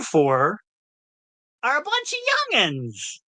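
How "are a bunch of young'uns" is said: squeaky high voice breaking; voice cracking high. Acted. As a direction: voice jumps up uncontrollably. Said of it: "I can't control my voice".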